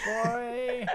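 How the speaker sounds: high mock echo. Echoing the whispered taunt.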